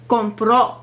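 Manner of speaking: "compró" with PRÓ stressed and held; stress on last syllable. Shown as a demonstration. Teacher showing a rule break, stress on PRÓ in "compró". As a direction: punch the final O of "compró". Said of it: "stress is put on the last syllable".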